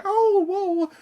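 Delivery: very high mock-admiring coo, slowing at the end; mock fans who praise her; sarcastic fawning. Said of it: "I'm always going".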